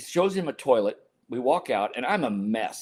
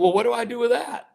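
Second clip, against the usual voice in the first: high-pitched retold dismay; hammed-up worried question; mock alarm; 11 semitones higher than usual. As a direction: ask it helplessly, pitch climbing to the end. Retold panicked question to translator.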